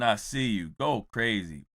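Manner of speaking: usual speaking voice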